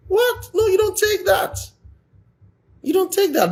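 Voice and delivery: high, indignant no you don't take that; a sharp high cry of outrage; outraged disbelief. Real, not acted. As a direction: protest in a high, scandalised voice. Outraged reaction.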